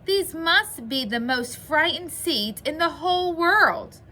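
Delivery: high, loud storybook lament; crying out. A narrator's reading. Toad despairing over his frightened seeds, crying out. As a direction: exclaim it in exasperated dismay. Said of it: "cried Toad".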